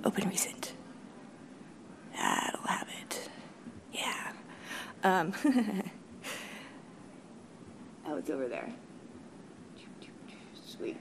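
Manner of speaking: very soft, half-whispered asides; muttering to self. Real, not acted. Speaker hunting for a file mid-talk, absent muttering. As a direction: murmur under your breath, barely voiced.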